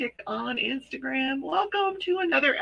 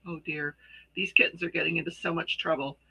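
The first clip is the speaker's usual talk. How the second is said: usual speaking voice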